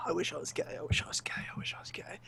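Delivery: soft, breath-only muttered plea; whispered wishing. Retold or imitated. Man's inner thought in an awkward moment, desperate whisper. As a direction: whisper it repeatedly like a private prayer.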